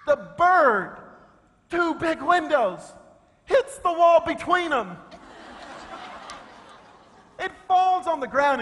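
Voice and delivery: high, loud, rising excitement; excited storytelling. Real, not acted. To the congregation, a wild bird crash, excitedly. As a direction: tell the crash like a thriller.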